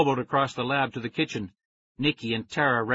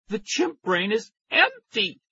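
higher, slow alarmed outburst; frantic cry; 9 semitones higher, slower than usual. A narrator's reading. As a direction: cry it out in a panic. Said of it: "I cried".

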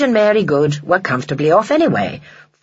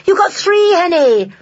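high, louder cheer; excited cry; 13 semitones higher, 5 dB louder than usual. A narrator's reading. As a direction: call it out high like thrilled children. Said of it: "cried the children".

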